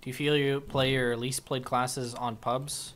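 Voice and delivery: ordinary talk, nothing marked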